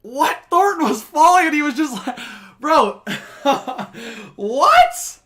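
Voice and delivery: high, loud, laughing disbelief; can't believe what you just saw; incredulous shout. Real, not acted. Disbelief at the penalty call.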